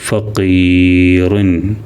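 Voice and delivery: ഫഖീറുൻ very slow, ഖീ held long; teacher reading ഫഖീറുൻ slowly; slow model reading. Shown as a demonstration. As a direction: draw ഫഖീറുൻ out syllable by syllable. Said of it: "ഫഖീറുൻ എന്ന് വായിക്കാം".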